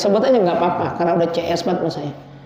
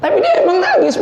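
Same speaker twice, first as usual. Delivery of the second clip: usual speaking voice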